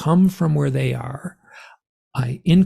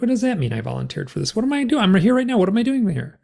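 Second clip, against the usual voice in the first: high, rattled string of questions; retold frantic demand for answers; bewildered protest; 8 semitones higher, faster than usual. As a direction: act out rising panic and confusion. Retold bewildered arguing with the beings.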